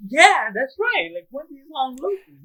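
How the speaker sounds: cheering Brock on as he confronts Tyr; cheering along. Retold or imitated. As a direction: egg him on smugly. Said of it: "And I was like".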